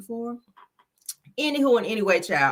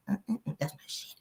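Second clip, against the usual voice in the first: whisper; 4 semitones higher, 10 dB quieter, much faster than usual. Real, not acted. Aside about the song.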